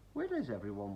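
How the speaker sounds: ordinary delivery, nothing marked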